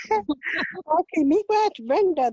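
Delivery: laughing. Real, not acted. Laughs after long introduction.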